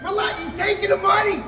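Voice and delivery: high-pitched frantic protest; mock panicked protest. Groom protesting to his wife about the DJ. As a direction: squeal it back at her.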